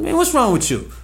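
student calling to teacher, high and teasing; high loud call. Retold or imitated. Calling out to Miss Stephanie.

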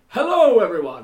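put-on high, loud cracked old man; Professor Farnsworth. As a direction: squawk it like a wacky old professor. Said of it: "Professor Farnsworth of, uh, Futurama".

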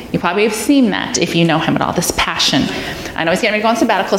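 ordinary talk

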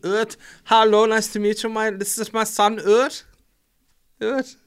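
put-on high, loud comic German accent; mock German. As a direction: introduce your son in a jokey German voice. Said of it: "It's German".

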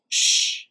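sharp "shh" cutting him off; imitated shush. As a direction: hush the questioner. Partner hushes Joe in story.

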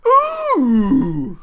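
loud, high, wired yelp; hyped up on coffee; too much caffeine. Real, not acted. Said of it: "coffee is kicking in".

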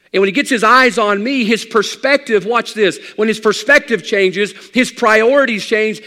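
loud, high, driving sermon climb; preaching at full force. Acted. Pastor preaching to his congregation, full force. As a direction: build it like a revival preacher.

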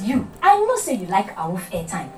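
ordinary talk

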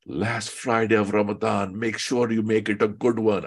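mock Desi accent, read like an ad. Teacher reads a Ramadan fundraising email aloud in a light Desi accent. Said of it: "I'm giving them a Desi accent".

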